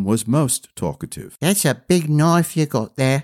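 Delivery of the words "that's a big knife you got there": put-on higher boy's voice, lightly done; a lad eyeing a sword; curious boy. A narrator's reading. Boy to the prince, lightly voiced higher boy.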